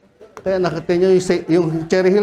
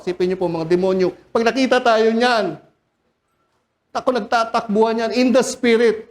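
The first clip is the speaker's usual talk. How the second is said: normal talking voice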